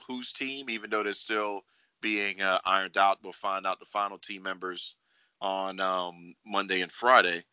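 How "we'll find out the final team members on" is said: normal talking voice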